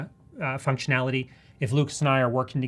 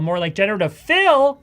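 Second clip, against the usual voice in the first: much louder and higher on fill; big, raised, joking emphasis on fill; hyped-up wordplay; 8 semitones higher, 10 dB louder than usual. Shown as a demonstration. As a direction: belt out the punchline playfully. Playful exaggerated repeat of phrase.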